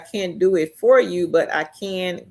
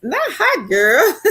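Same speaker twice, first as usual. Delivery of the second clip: much higher, louder, through laughter; laughing; 9 semitones higher, 5 dB louder, slower than usual. Real, not acted. Laughing at a chat message.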